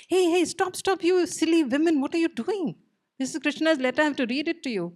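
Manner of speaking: high-pitched flustered rebuke; exasperated scolding. Acted. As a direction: shoo them off, fussing and indignant. Uddhav scolding the grabbing gopis.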